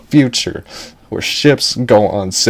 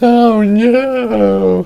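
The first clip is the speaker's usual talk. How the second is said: very high, drawn-out mock cry of dismay; hammed-up damsel in peril; dramatic wail; 13 semitones higher, 4 dB louder than usual. Girl falling from a building, hammed-up dramatic wail. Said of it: "it was all dramatic".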